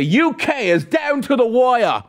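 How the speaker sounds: lightly put-on British accent, John Oliver style; mock British accent. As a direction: poke fun in a British accent. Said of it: "That was like a John Oliver accent".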